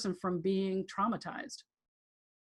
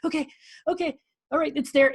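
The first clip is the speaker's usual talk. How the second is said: mock concentrating; 6 semitones higher, 4 dB louder, faster than usual. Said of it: "going like this".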